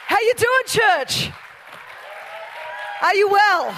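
loud, high holler to the whole room; big high-energy shout; shouted crowd greeting. Real, not acted. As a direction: call out to fire up the congregation.

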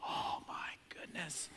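put-on slow, breathless kid whisper; awed whisper. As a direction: whisper it in pure awe. Kids awed by ice cream bar.

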